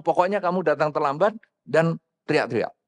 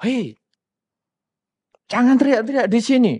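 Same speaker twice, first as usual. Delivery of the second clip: raised, higher-pitched telling-off; angry scolding; 6 semitones higher, 4 dB louder than usual. Acted. Impatient person telling a latecomer to stop shouting, angry. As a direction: act out scolding a noisy latecomer. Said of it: "kita marah sama dia".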